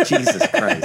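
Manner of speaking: a real laugh, then the exclamation on its tail. Real, not acted. To friends, realizing how long ago it was, laughing exclamation. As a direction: laugh out an exasperated Jesus Christ.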